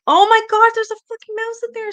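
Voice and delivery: high, loud panicked exclamation; retold freak-out. Streamer retelling finding a dead mouse, freaking out. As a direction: pitch it way up, loud, horrified at the find. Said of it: "I was like".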